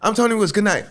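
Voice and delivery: high, called-out goodnight; abrupt stage sign-off. Retold or imitated. Nervous new comic signing off at the light, high call-out. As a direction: call it out to the room, pitched well up.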